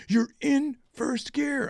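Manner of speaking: slow, high mock Swedish accent. Swedish buyer correcting his gear habit, slow high Swedish accent. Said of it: "in his Swedish accent".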